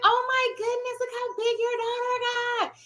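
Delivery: mock-amazed high voice; excited gushing. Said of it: "she got so excited".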